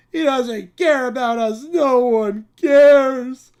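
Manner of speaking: put-on bratty child. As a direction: whine like a spoiled child. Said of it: "the Brat Pack".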